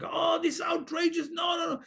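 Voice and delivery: parodying a complaining voice; mock complainer. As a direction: ham up a whiny grumbler. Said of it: "Complaining is like".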